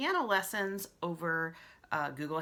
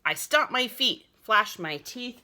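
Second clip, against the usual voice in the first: ordinary delivery, nothing marked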